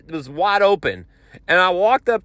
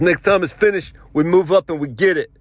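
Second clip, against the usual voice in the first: put-on voice, a bit raised; New Yorker guy voice; 2 semitones higher, 4 dB louder than usual. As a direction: imitate the impatient guy rallying his teammate. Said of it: "I can only do the voice one way".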